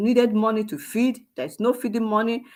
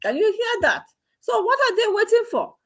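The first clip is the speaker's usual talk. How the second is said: excited outburst; 12 semitones higher than usual. Real, not acted. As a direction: pitch jumps much higher, eager and pressing. Reacting to played history clip.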